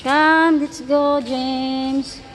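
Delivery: loud, stretched-out call. Real, not acted. Calling child to move on.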